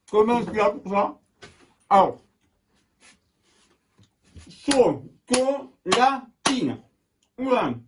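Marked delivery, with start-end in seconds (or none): 4.5-6.8 high, slow "chocolatine" in four beats; syllable by syllable. Shown as a demonstration. Teacher has pupils count the parts, syllable by syllable. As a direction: chop "chocolatine" into cho, co, la, tine. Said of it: "T'as compté?".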